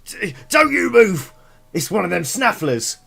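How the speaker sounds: high, loud alarmed kobold cry, easing down; panicked kobold. Acted. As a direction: shriek at the stranger, then accuse. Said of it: "they look at you and they go".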